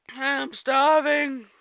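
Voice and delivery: stretched-out, loud, high cry of hunger; loud starving wail. Acted. Fluttershy confessing to Discord she's starving, loud drawn-out wail. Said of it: "I'll redo that".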